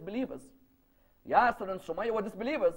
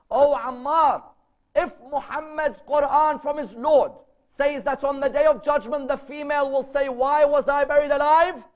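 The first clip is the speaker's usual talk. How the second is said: loud, high, swelling proclamation; impassioned declaration; 7 semitones higher, 8 dB louder than usual. Retold or imitated. Father to Ammar on hearing the verse, impassioned declaration. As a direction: proclaim it at full force, voice held high.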